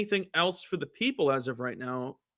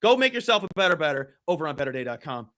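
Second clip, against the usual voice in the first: energetic, louder site plug; promo read; 4 semitones higher, 6 dB louder than usual. Acted. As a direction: punch up the sales pitch to the listeners. Promotes the show's betting site.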